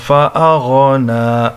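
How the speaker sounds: slow, melodic tajweed recitation; Quran recitation. Acted. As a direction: recite in long, drawn-out measured tones.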